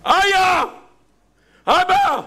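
high, loud cry for father; demonstrated pleading shout. Shown as a demonstration. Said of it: "אתה צועק?".

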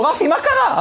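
a nurse shocked at the chaos; alarmed nurse. Retold or imitated. The nurse asks Rafi in sudden high alarm. Nurse finds doctor in panic.

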